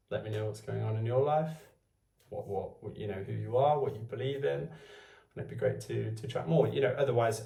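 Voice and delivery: ordinary delivery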